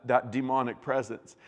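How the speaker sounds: ordinary talk, nothing marked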